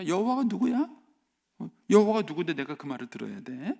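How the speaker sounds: loud, high scornful challenge; a proud king scoffing at God; defiant Pharaoh. Acted. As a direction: throw the question back in disdain. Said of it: "이렇게 말을 하는 거예요".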